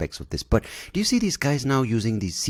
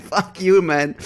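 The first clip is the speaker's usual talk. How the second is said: mock retort; 11 semitones higher, 5 dB louder, slower than usual. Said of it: "I'm like".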